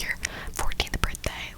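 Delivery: ordinary talk, nothing marked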